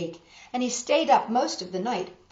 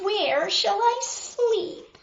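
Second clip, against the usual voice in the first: much higher, slow whiny devil voice; fussy devil; 13 semitones higher, 4 dB louder, much slower than usual. A narrator's reading. Fussy devil demanding a bed from Paper John. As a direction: drag it out, spoiled and fussy.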